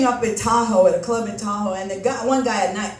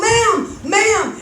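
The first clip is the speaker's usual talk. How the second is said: put-on kid calling out; 8 semitones higher than usual. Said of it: "He's all".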